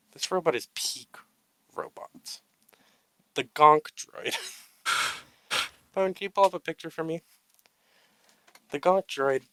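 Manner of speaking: mock solemn tribute. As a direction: give a grand, mock-serious speech.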